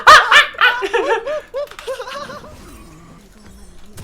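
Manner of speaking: wild high laughter; high shrieking laugh. Real, not acted. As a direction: burst into helpless laughter. Laughs after death. (자지러지게 웃으며)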